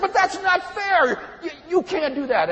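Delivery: high, loud cry of unfairness; indignant protest. Retold or imitated. Student objecting to professor's grade on the paper, indignant.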